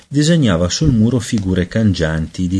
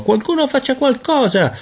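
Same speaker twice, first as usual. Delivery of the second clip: crying for help. A narrator's reading. Peter trapped in baby body, crying out in panic. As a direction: beg for help like a frightened child. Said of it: "gridò".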